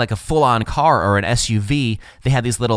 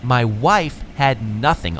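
ordinary talk

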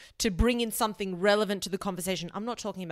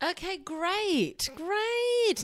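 sarcastic great; 11 semitones higher, much slower than usual. Real, not acted. Sarcastic reaction to own point.

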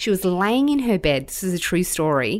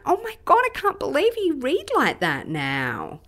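amazed, pitched way up; retold amazement; 9 semitones higher than usual. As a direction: gush in disbelief at your child. Retelling amazement at son reading.

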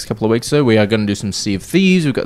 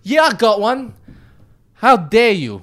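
high-pitched attempt at a Brooklyn accent; badly faked Brooklyn voice, pitched well up; 10 semitones higher, slower than usual. Host reads a listener's question aloud, bad Brooklyn accent. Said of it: "read it in the best, uh, Brooklyn accent I can".

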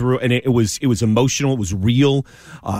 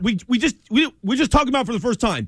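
exasperated, higher-pitched objection; incredulous retort; 9 semitones higher, faster than usual. Retold or imitated. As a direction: push the pitch up in mild disbelief. Mock retort to repeated crutch phrase.

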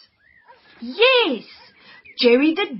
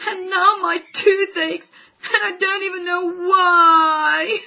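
wailing through tears, louder at the end; crying; 3 semitones higher, 3 dB louder than usual. Acted. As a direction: cry it out in despair. Wanda cries about toothache.

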